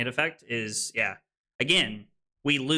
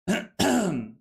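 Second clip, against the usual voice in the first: throat clear; 5 semitones higher than usual. Real, not acted. Throat clearing, something stuck.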